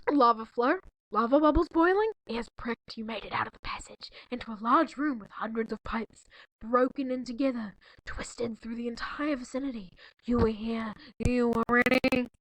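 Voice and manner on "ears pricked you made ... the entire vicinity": hushed breathy read, voice gone; whispered reading. Acted. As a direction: whisper the story like a secret.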